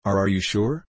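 timid eagerness. A narrator's reading. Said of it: "with the timid eagerness".

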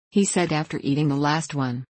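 ordinary delivery

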